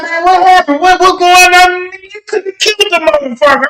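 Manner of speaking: high-pitched, frantic acted exchange; hammed-up alarm; mock agitation. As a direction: voice way up, acting out a roadside argument. Acted traffic stop quarrel.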